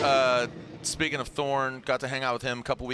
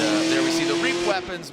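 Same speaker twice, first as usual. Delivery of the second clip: excited announcer; 7 dB louder than usual. Real, not acted. Hype commentary.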